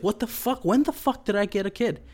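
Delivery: put-on Chucky can't believe he has a kid, high and incredulous; stunned Chucky.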